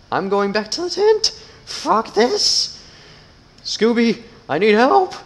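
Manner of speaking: high, loud, scared shouting; panicked yelling. Acted. Gamer fleeing a monster, panicked high yelling. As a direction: yell for help as you flee.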